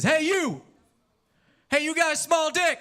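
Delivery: high, loud mock yell; yelling out the window. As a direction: holler at a stranger from the car. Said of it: "He yells out the window".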